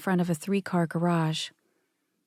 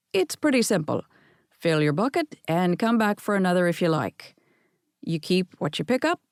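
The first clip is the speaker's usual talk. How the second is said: lightly done Scottish grandfather addressing the pickers; a hint of a Scottish brogue, genial host; mild Scottish burr, welcoming; Scottish accent. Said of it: "right off a plane from Scotland".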